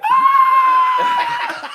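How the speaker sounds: high squealing battle cry; mouse battle cry. Retold or imitated. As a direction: shriek like a charging mouse. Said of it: "he has his cry like".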